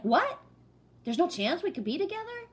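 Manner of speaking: high-pitched incredulous questioning; imitating a rejected suitor's disbelief; mock disbelief. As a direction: act out his stunned question, rising high. Retold boss hears rejection.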